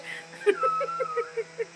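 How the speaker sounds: very high squeal of laughter. Real, not acted. Wife retelling husband's accident, squealing laugh. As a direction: let the laugh climb into a squeal.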